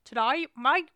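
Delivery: put-on Australian accent. Co-host butts into the sign-off, loud mock Australian. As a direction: chime in with a cheeky Australian twang. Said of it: "that's my Australian accent".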